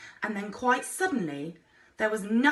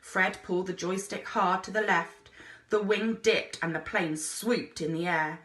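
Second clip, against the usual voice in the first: plain reading voice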